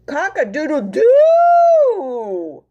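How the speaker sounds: long high loud crow; rooster crow. Acted. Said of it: "crowed Rooster".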